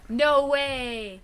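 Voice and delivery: loud, thrilled no way; excited. Real, not acted. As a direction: burst out in delight. Excited gasp at sparkling Albarino.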